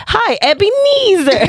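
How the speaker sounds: high, slow teasing greeting; teasing kid voice. Retold or imitated. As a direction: sing the name out high to tease. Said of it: "I'm gonna be like".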